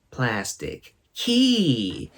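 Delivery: very slow, drawn-out plastic key; slow imitation. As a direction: stretch each word out like a child guessing. Quoting her wrong answer.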